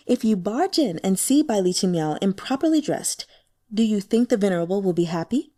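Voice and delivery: usual speaking voice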